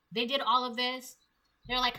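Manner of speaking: normal talking voice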